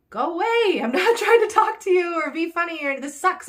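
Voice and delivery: high, loud half-laughing go away; exasperated outburst. Retold or imitated. Vlogger venting at pestering runners, loud and half-laughing. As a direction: blow up at them, laughing through it.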